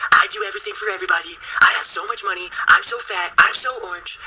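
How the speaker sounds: boastful presidential parody; Donald Trump. Retold or imitated. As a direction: brag in a put-on New York accent. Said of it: "stuck with that accent".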